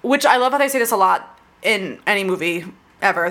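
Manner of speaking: usual speaking voice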